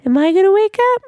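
high, loud disbelieving echo; incredulous. Real, not acted. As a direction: shoot the question back in disbelief.

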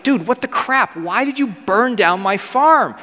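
high-pitched retold indignation, drawn out at the end; mock outrage. Joab confronts Absalom over his burned farm, mock outrage. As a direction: demand an explanation in mock exasperation.

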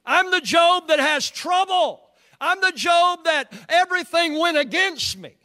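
high, loud complaining woe-is-me; hammed-up wail of grievance; mock self-pity. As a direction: play up the poor-me complaint. Mocks self-pitying believers' complaint.

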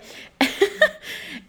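breathy giggle after a burp; embarrassed laugh. Real, not acted. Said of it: "sorry, I just burped".

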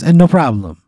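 put-on high, loud Italian accent; hammy Italian waiter voice; bad Italian accent. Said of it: "a really bad Italian accent".

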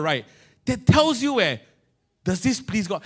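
ordinary talk

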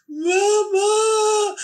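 put-on long high-pitched wail of a child; imitated toddler wail. Upset toddler screaming for mum in the night, imitated wail. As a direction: draw it out like a wailing toddler. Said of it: "like, absolutely wailing".

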